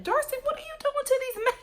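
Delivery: high-pitched, squealed mock dismay; mock appalled reaction. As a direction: squeal it as if scandalized. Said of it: "I was just like".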